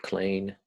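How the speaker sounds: clean said as 'clane'; broad Australian 'clane'; Aussie clean. Shown as a demonstration. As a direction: slide the EE of clean to 'ay'. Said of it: "he uses the word clane".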